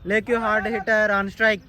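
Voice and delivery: slow, loud drawn-out commentator call; drawn-out excited call. Real, not acted. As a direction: stretch every word out loud. Big hitter comes in.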